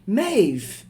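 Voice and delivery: high, loud sudden yell of her name; shocked cry as the flask flies; mother yelling in shock at her daughter; startled shout. Acted.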